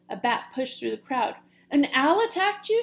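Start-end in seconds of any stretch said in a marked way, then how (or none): 1.7-2.8 high, loud alarmed question. Acted. Mother bat alarmed about the owl attack, high. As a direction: ask in sudden alarm. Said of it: "she asked".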